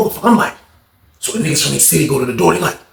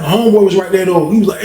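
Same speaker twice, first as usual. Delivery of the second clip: usual speaking voice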